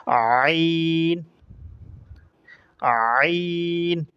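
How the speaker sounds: আইন stretched very long and pressed from the throat; throaty ain. Shown as a demonstration. Teacher models the Arabic letter আইন, long and throaty. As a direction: hold আইন slowly, squeezing the throat. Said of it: "এভাবে বলতে হবে".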